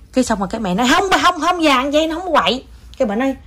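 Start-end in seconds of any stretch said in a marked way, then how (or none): 0.9-2.6 high-pitched protest, acted out; acted hurried denial. Mother in her tale defending child to old woman, high-pitched denial. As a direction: plead the child's innocence in a raised pitch.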